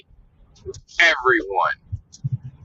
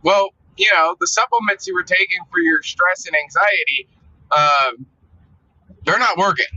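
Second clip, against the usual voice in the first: higher, smug know-it-all tone; the body explaining like a smug sidekick; body's snide voice; 6 semitones higher than usual. Retold or imitated. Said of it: "once I got past that threshold where, like".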